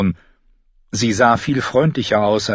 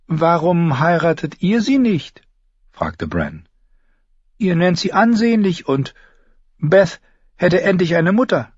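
put-on higher, eager boy's voice; child asking the old knight a hopeful question; young boy Bran; 10 semitones higher than usual. A narrator's reading. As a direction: ask it like an earnest young prince. Young prince Bran speaks.

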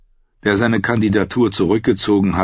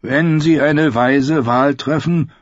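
slow, mournful higher voice; gloomy complaint; 8 semitones higher, slower than usual. A narrator's reading. Glum Ed jokes about the vote, slow and mournful. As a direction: moan it like a born pessimist. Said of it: "klagte der schwermütige Ed".

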